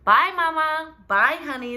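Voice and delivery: high, loud call out the door; calling goodbye. Acted. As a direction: shout bye to Mama leaving. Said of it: "they called".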